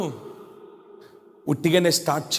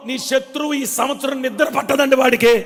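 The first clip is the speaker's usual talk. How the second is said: loud, high fervent preaching cry; preaching at full force; 9 semitones higher, 3 dB louder than usual. Acted. Preacher to his congregation, shouting with full fervour. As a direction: rouse the congregation with rising shouts.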